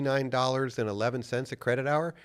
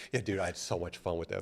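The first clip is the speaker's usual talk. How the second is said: ordinary talk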